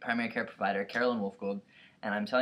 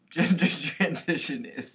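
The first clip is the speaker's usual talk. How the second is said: laughing; 6 semitones higher, 7 dB louder, slower than usual. Real, not acted. Laughs while rereading.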